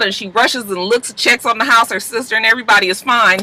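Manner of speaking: normal talking voice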